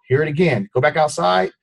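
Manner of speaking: ordinary delivery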